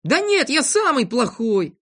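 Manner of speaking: put-on high, drawn-out boyish protest; slow, high-pitched pouting voice; self-blaming sulk. A narrator's reading. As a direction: insist stubbornly and slowly. Sulking boy insists he is worst.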